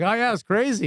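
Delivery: high-pitched placating agreement; mock-agreeable reply; retold humouring. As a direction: play along, pitched well up. Humouring the ranting star.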